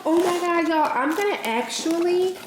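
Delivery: excited gush. Real, not acted. Seeing the hot cocoa cup, gushing to viewers. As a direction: bubble over with excitement.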